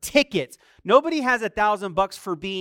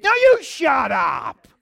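loud, higher, slowed snap back; angry retort; 6 semitones higher, 10 dB louder, slower than usual. Pretend. Driver yelling back at angry driver, loud angry snap. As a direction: fire it back at the other driver.

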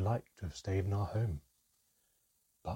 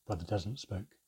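usual speaking voice

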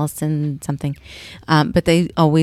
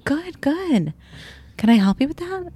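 smooth flirt; 10 semitones higher than usual. Retold or imitated. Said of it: "He's like".

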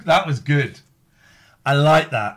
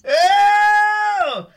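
triumphant shout; 10 dB louder than usual. Real, not acted. Winning the game.

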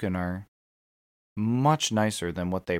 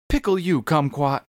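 higher, a hint of the ad's threat; mock threat; 6 semitones higher than usual. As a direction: toss it back up high. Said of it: "Lint Licker commercial".

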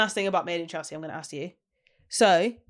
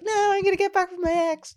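mock stubborn friend; 12 semitones higher than usual. Mocking a friend going back to her ex, high and defiant. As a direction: play the stubborn friend ignoring advice. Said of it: "you're just like".

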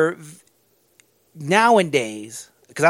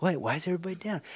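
much softer, fast puzzled question; mock puzzled; 12 dB quieter, much faster than usual. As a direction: ask it hurriedly under your breath. Coach puzzled by gloom.

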